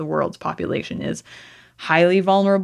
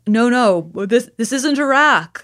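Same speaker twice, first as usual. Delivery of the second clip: mock news anchor; 6 semitones higher, 4 dB louder than usual. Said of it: "It's like, oh God".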